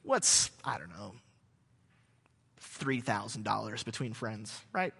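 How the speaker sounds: low, slow, half-whispered haggling; name the price softly, all innocence; sly seller. Retold or imitated. Local seller quietly naming his price to the archaeologist, low and sly. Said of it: "He's like".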